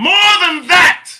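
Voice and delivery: loud, high outburst; exhorting shout. Real, not acted. Host urging listeners toward the kingdom, shouting. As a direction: throw it out at full volume.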